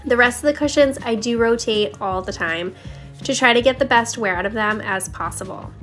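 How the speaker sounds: usual speaking voice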